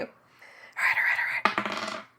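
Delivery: murmured under the breath in a whisper; steady yourself in a whisper; whispered to self. Real, not acted. Player pulling a Jenga block, whispering to herself.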